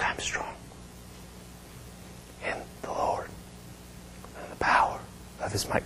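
halting whisper with long gaps; barely whispered. Retold or imitated. Wasting-away woman repeating her confession, halting voiceless whisper. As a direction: force each phrase out on faint breath. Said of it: "but it's more like".

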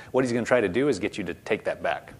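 ordinary talk

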